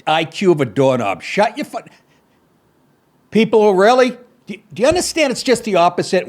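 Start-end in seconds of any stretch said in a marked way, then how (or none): 1.2-6.3 high, fast outraged disbelief; fed-up outrage; exasperated rant. Real, not acted. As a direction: rant back at the ad. Ranting at the ad clip.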